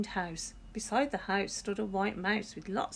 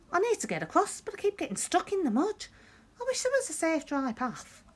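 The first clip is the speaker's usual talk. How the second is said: much higher, pleading; high whiny dog voice; 11 semitones higher, 3 dB louder, faster than usual. A narrator's reading. The dog whines to George about the bog. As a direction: whine like a stuck, howling dog. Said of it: "a dog who was howling".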